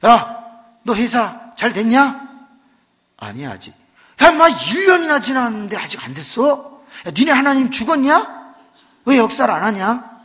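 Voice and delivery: loud, high taunting; mocking friend. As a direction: rib him hard about his God. 친구가 놀림. (친구가 크게 높여 놀리듯)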